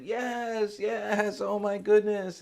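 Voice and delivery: high, loud burst of acted delight; overjoyed. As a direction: play a man bursting with happiness. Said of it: "he's just so happy and laughing".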